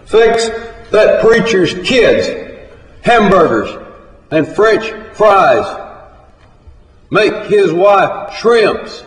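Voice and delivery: loud, high call to the kitchen; hollered order. A narrator's reading. Cafe owner calls to the cook from the register, hollering. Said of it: "Hollered through the window".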